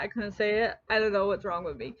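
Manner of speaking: normal talking voice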